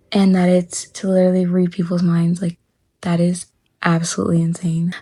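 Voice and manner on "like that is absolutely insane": ordinary talk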